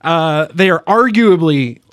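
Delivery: "arguably" drawn out and stressed; slow down and lean on "arguably". Shown as a demonstration. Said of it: "emphasis on arguably".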